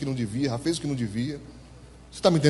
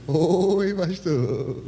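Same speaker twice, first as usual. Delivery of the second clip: sheepish voice; much slower than usual. Retold or imitated. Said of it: "com voz de ovelha".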